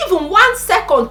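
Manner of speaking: normal talking voice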